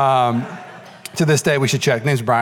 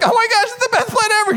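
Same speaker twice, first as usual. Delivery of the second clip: much higher, gleeful squeal; gleeful kid. Retold or imitated. Teen to his buddy, gleeful high squeal. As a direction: squeal like a ninth grader with a perfect plan. Kid gloating over rigged deck.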